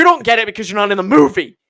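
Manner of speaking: loud, high, rapid rebuke; hammed-up heated retort; acted indignant outburst. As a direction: yell it defensively at a friend. Castmates argue with original Mario.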